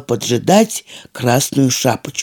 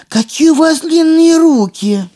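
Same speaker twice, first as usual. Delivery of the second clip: amazed; 11 semitones higher, 3 dB louder than usual. A narrator's reading. Girl amazed at grandma.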